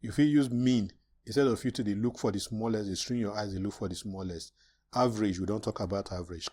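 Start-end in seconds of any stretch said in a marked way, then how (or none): none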